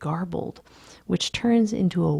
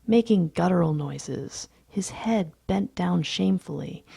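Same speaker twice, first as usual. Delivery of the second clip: ordinary delivery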